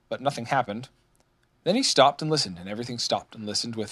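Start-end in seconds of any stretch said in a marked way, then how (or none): none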